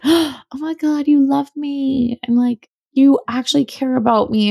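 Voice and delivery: a touch of mock swooning; gushing delight. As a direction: swoon over being cared for. Imagined delighted reaction to boss.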